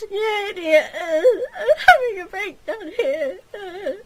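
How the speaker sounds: high, loud furious sob; angry crying snarl. Acted. Catherine snarls at Gilly for missing her words, crying and loud. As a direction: yell at him through tears. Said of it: "Catherine snarled angrily at him".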